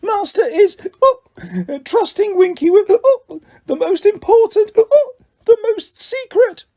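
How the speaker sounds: very high, slow, slurred confiding squeak; high tipsy house-elf voice; drunk squeaky elf. A narrator's reading. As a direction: whisper a drunken secret as an elf.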